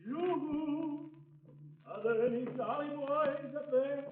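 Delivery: calling out. Acted. Calling up from the street.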